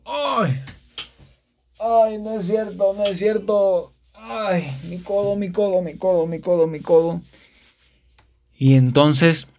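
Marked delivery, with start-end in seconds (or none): 0.1-7.2 high, whining mock lament over money; whiny wail. Whining to himself over the eighty-peso fee, high mock wail. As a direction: wail like a stingy crybaby.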